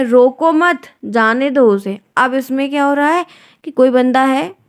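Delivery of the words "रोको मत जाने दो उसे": रोको मत joined as one unit, then जाने दो उसे; pause after मत. Shown as a demonstration. Teacher showing the second meaning, pause after मत. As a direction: run रोको into मत, break after it. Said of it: "दूसरे अर्थों में आप देखिए".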